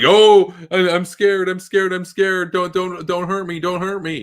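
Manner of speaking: high, whimpering mock plea; squeaky fake-terrified begging; mock scared Putin. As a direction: ham up a cowering bully begging. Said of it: "and he's like".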